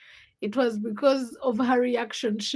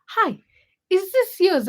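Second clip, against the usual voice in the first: high, louder, sweetly polite; a high sweet voice to her seatmate; imitating herself; 8 semitones higher, 7 dB louder than usual. Asking the seatmate about the shoe sole, high and sweet. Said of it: "I ask her".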